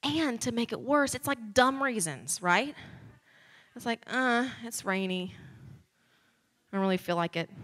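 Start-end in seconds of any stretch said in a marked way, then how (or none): 4.1-7.5 lazy excuse. Pretend. Mock lame excuse to Jesus.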